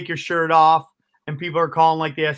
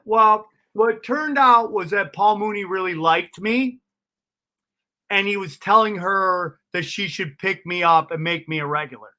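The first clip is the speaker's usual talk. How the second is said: normal talking voice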